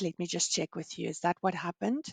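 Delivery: ordinary delivery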